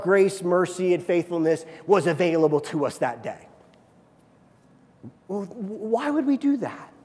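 ordinary talk

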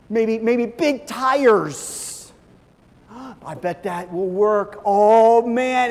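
hammed-up awe, high and loud, slow; dazzled buyer. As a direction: gush over the shiny new car. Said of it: "by the car dealership and we go".

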